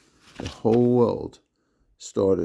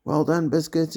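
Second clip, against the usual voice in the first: shouted praise; 8 semitones higher than usual. A narrator's reading. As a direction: shout it down to a friend below. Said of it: "but I shouted".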